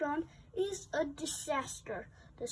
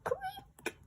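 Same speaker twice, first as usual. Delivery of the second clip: very high creaky squeak; creaking sound; 6 dB quieter than usual. A narrator's reading. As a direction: squeak it like an old hinge.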